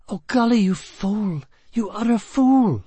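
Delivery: very high, exasperated reproach; exasperated woman. A narrator's reading. Trapped woman blames her partner for the failed escape, exasperated. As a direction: berate him, high and slowing at the end.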